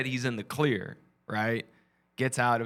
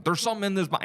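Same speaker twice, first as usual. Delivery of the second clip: higher put-on Texan voice; Texas voice; 7 semitones higher, much faster than usual. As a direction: act out the fake officer. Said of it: "And he's doing his voice".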